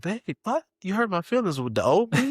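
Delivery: high, wounded little voice; mock hurt. Husband hamming up hurt feelings to his wife, high and wounded. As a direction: sound overly hurt, playing it up. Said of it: "I'm like".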